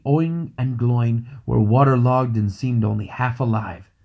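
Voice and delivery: ordinary delivery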